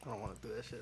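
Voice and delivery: quietly voiced inner thought, under the breath. Mechanic voicing his silent thought to friends, quietly. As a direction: say it like you're only thinking it. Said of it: "In my head, I'm like".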